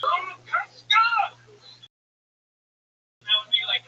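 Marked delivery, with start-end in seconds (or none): 0.0-0.9 mock angry. Shown as a demonstration. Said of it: "means I'm angry".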